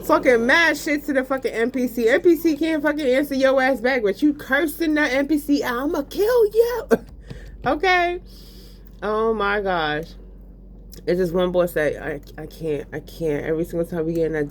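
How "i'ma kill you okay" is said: high playful threat; mock threat to NPC. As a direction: threaten the game character in a put-on voice. Cursing the NPC out.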